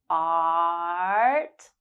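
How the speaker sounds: long drawn-out ah in "Art"; held ah. Shown as a demonstration. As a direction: stretch the ah of "Art". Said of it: "hold ah for three seconds".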